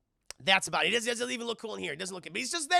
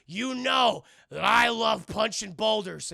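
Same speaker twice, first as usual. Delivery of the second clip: slow, loud mock-heroic declaration; hammed-up solemn reveal; dramatic confession; 9 dB louder, slower than usual. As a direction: declare it proudly, stretching the words. Acts Chris's dramatic revelation.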